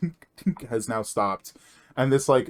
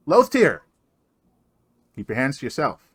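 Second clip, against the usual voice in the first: scolding call; 3 semitones higher, 5 dB louder than usual. Acted. Dwarf scolding.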